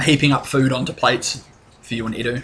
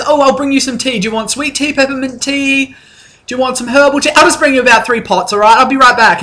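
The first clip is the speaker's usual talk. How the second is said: much higher, louder, sing-song hostess voice; wife Alyssa; 11 semitones higher, 4 dB louder, faster than usual. Acted. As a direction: gush like a bubbly hostess, pitched way up. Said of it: "She says".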